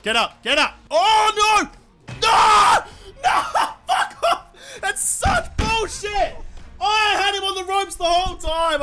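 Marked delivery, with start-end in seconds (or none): 0.9-3.9 loud shout. Real, not acted. Being thrown out of ring. 3.9-8.8 furious yell. Real, not acted. Losing the round, raging.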